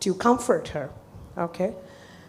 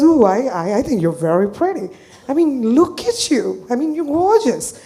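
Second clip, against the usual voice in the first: loud, swooping, gushing praise; emphatic flattery; 3 semitones higher, 5 dB louder than usual. Retold or imitated. Therapist reassuring a client she is pretty, gushing. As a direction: insist on it with big admiring emphasis.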